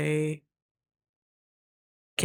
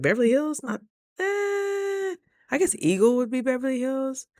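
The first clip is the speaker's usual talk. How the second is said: musing aloud; 8 semitones higher than usual. Retold or imitated. Quoting own thinking aloud.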